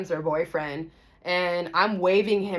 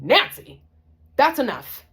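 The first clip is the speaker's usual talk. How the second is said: loud, raised command; stern intervention; 8 semitones higher, 9 dB louder than usual. Retold or imitated. Narrator stopping friend hitting boyfriend, stern shout. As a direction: cut in sharply and loudly, retold.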